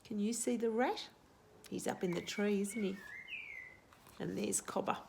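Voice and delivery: plain reading voice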